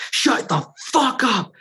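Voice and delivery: high, slow, stretched-out yell; imitated scream. Host reenacting the neighbor yelling at his dog, high drawn-out yell.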